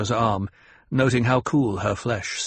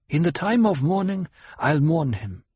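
tribeswoman; 6 semitones higher than usual. A narrator's reading. Hera answers in character.

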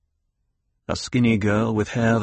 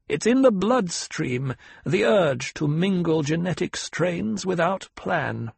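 put-on higher, stern old woman; a demanding elder questioning her pupil; old Reverend Mother; 7 semitones higher than usual. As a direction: severe old matriarch.

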